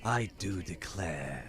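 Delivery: slow, drawn-out genteel Gorn; Bridgerton Gorn. Shown as a demonstration. Streamer improvises a line to Mister Darcy, Bridgerton Gorn voice. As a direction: drawl it out like a period-drama creature. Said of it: "I'll just do, like, a".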